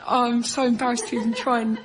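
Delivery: ordinary talk, nothing marked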